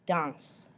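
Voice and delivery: Danse with a nasal "ahn" in the middle; French nasal vowel. Shown as a demonstration. Teacher shows French for dance, nasal middle in Danse. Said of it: "that's the un sound in the middle".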